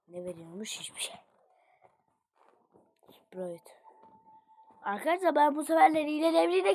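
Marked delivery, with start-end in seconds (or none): 0.1-1.2 soft whispered question. Real, not acted. Quiet aside while checking shop. 3.1-3.8 a single whispered word. Real, not acted. Quiet aside.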